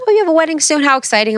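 high, bubbly imitation of a chatty clerk; sing-song store clerk cooing over a wedding; mock gushing clerk. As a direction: play up her excitement, pitch way up.

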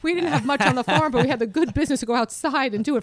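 high, loud, laughing Irish mother appalled; mother scandalised by a toilet beside the kitchen, voiced high and loud; mother's mock disgust. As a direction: play the scandalised mum from the farm, half laughing. Mother's outrage at indoor toilet.

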